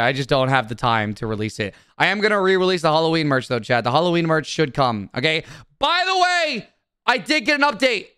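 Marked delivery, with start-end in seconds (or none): 5.8-8.1 excited announcement. Real, not acted. Streamer breaking news of an update to chat, excited announcement. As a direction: burst in with a high, emphatic announcement.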